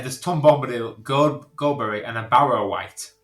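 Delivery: ordinary delivery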